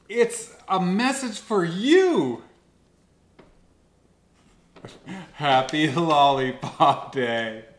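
loud, slow, excited reveal. Real, not acted. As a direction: announce it with glee. Reads message excitedly.

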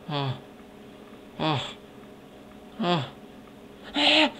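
short high cries climbing each time; rising series of high-pitched ahs; high "ah" noises. Pretend. Toying with high vocal sounds.

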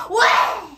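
loud grumpy roar; mock roar. Sleepy person roaring at those who warn them, mock grumpy roar. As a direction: roar back like a cranky sleeper. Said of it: "you roar at them".

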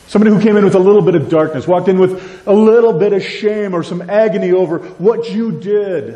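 loud, high, fast sermon push; impassioned preaching. Real, not acted. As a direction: drive it home to the congregation. Preaching at full force.

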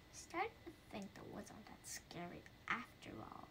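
unvoiced whispered aside. Ghost musing to himself, whispered aside. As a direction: breathe it as a sly aside.

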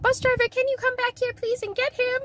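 high mock kid voice calling out; high pleading kid voice. Kids calling the driver.

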